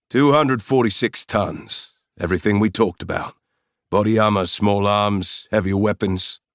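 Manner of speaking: put-on South African accent, listing the cargo; proud South African inventory. Mercenary lists the weapons shipment, South African accent. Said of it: "With a strong South African accent".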